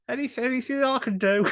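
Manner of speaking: high, eager begging; pleading voice. Retold or imitated. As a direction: ask hopefully for any part. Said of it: "knock on your door and just like".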